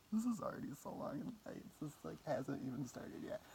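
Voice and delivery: laughing through the words, breathy giggle. Real, not acted. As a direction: giggle it out at yourself. Laughing at own rambling.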